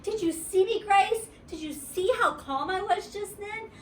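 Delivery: high, bright proud questions, calling Grace; bursting with pride; joyful. A narrator's reading. Junie B clapping and showing off to Grace, high and joyful. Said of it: "clapped my hands very joyful".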